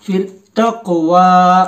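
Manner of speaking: तक़वा read slowly with क़ held closed; slow Quran-tutor reading; jazm blending. Shown as a demonstration. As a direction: join the क़ to the व, slowly. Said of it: "ये जो जज्म होता है".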